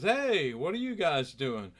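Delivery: hearty hello, pitched high and loud at first; loud high "hey", easing into friendly chat; jovial greeting. Retold or imitated. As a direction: greet them like a big-hearted, jovial star. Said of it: "real jovial".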